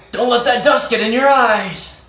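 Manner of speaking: high, loud mock warning; shouted warning. Captain Clumsy to the villain to distract him, shouted warning. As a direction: call it out sharply as a distraction.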